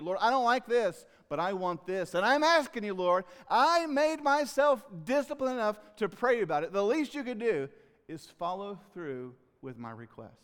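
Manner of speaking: a mock prayer from someone who feels owed an answer; entitled prayer. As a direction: whine at God like you're owed.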